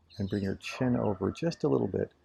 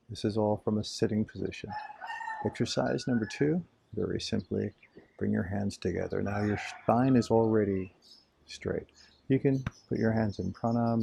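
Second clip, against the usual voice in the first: ordinary delivery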